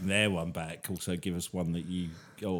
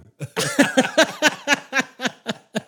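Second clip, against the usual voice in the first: high loud laugh; 10 dB louder than usual. Real, not acted. Friend laughing loudly at the French voice.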